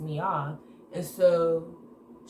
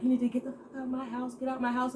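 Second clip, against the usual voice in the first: high, fast mock yell at a friend; retold screaming friend; 7 semitones higher, faster than usual. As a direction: replay her shrieking to get out, pitched high. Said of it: "so she's screaming".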